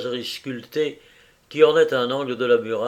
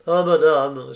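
respectful reproach; 4 semitones higher, 7 dB louder, slower than usual. A narrator's reading. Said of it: "sur un ton de respectueux reproche".

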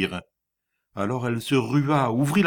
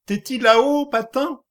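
a high, loud anxious call to the ceiling; calling upward; 13 semitones higher, 5 dB louder than usual. Acted. Frightened wife calling up to the attic, anxious call. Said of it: "la tête levée vers le plafond".

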